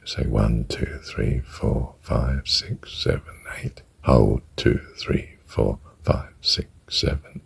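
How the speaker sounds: soft breathy count in a whisper; hushed counting. Shown as a demonstration. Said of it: "you'll just count".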